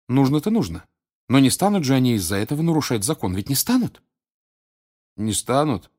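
лёгкая тревога в голосе Гермионы; спросить чуть обеспокоенно, ища подтверждения; беспокойство. Acted. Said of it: "Настала очередь Гермионе проявить беспокойство". (с лёгким беспокойством)

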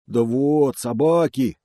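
loud, high bear complaint; complaining bear. Acted. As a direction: whine like a battered bear.

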